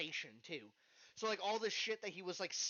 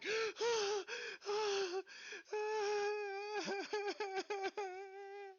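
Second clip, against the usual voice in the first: long, high gasps for air; imitating someone gasping in shock after being trapped; panicked gasping. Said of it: "just going".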